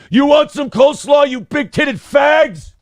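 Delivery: imitating hateful chat heckling the hosts, shouted; mock troll shout. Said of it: "It's just my impression of what that show might sound like".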